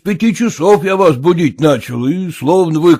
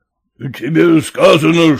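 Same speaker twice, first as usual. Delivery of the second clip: usual speaking voice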